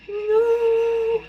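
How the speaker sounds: high, loud scream of no; shocked cry. Real, not acted. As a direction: yell at the screen in dismay. Reacting to fight on screen.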